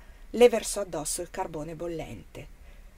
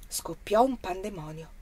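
plain reading voice

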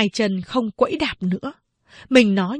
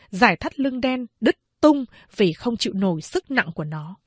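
ordinary delivery

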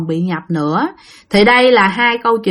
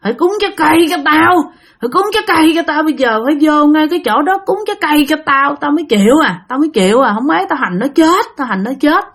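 put-on higher, growling, menacing old crone voice; fierce old woman ghost; 6 semitones higher, faster than usual. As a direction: snarl demands as a possessing old woman spirit. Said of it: "Mà nói vậy đó".

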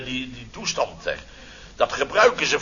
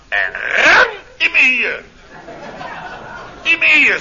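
pained yell; 10 semitones higher, 7 dB louder than usual. Retold or imitated. Retelling the dog bite with a loud, high, pained yell. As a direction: howl it like the dog's teeth just sank in.

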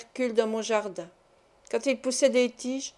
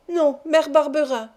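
tearful refusal; 5 semitones higher, 5 dB louder than usual. A narrator's reading. Boy refusing, in tears.

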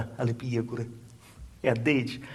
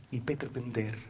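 ordinary delivery

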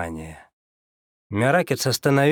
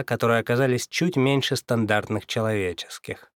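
ordinary delivery, nothing marked

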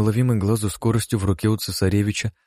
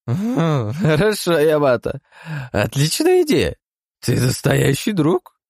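high, slow, happily slurred agreement; drunk and mollified; 10 semitones higher, 4 dB louder, slower than usual. Acted. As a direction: soften up, drunk and content. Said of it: "Моментально подобрев".

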